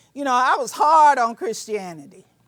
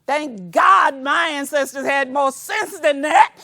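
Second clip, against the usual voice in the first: loud, raised preaching voice, pitch up; shouting; 4 semitones higher, 4 dB louder than usual. Real, not acted. Speaker shouting her pride in ancestors to the audience, loud. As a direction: shout it out to the whole hall. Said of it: "I wouldn't be here shouting at you today".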